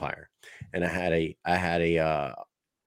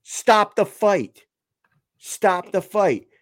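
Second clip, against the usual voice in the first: high, slow shouted call; mock yell; 10 semitones higher, 5 dB louder, much slower than usual. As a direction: yell it like the ref should have. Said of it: "should have said".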